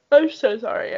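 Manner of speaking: ordinary delivery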